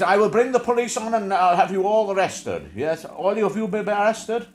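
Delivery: high, loud mock threat; Nazi stormtrooper impression. Host mimics Priti Patel threatening arrests, stormtrooper impression. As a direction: threaten them like a caricature stormtrooper. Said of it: "a Nazi stormtrooper and goes".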